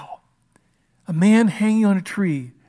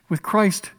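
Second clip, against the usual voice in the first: ordinary delivery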